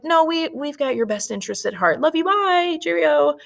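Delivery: high sing-off farewell, rising at the goodbye; mock dismissive England. England hanging up on the colonists, mock breezy brush-off.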